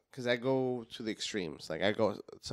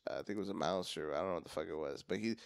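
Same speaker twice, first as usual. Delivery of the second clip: ordinary talk, nothing marked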